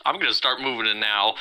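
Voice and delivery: ordinary delivery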